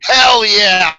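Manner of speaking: loud, hyped hell yeah; pumped shout; excited cheer. Real, not acted. Excited at movie title.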